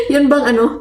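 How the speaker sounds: ordinary talk, nothing marked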